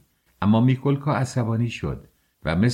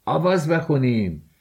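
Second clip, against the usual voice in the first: higher-pitched call, lightly shouted; rally the group with a raised voice; 5 semitones higher than usual. A narrator's reading. Someone in the cart calling for a song, shouting. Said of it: "فریاد زد".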